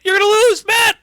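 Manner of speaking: frantic high full-throated yelling; JBL going crazy. Retold or imitated. Commentator losing it over Matt's match, frantic screaming. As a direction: yell it like an unhinged commentator. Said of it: "got J B L going crazy".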